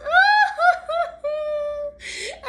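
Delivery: loud high mock sobbing; hammed-up wail; bear wailing. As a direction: bawl "oh-ho-ho" like a crying bear. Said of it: "He wails and he growls".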